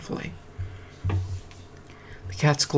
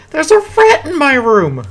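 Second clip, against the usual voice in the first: higher, much louder alarmed cry, slowed; 13 semitones higher, 12 dB louder, slower than usual. Acted. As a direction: yell in fright at the rat. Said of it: "she cried".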